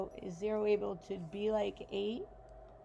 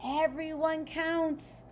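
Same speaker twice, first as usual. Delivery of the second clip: mild shout; 5 semitones higher, 4 dB louder than usual. Acted. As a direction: cheer it out together, lightly. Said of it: "the numbers shouted".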